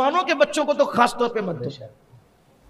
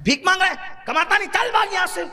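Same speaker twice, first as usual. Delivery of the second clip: mock scolding; 6 semitones higher than usual. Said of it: "کہتے ہیں".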